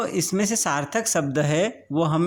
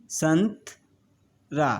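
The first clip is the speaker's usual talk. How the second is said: slow blended word; much slower than usual. Shown as a demonstration. Teacher to pupils, slowly blending the word. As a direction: draw out संतरा very slowly.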